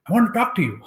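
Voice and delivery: retold demand, mock angry; imitating angry daughter. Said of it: "she just got really angry".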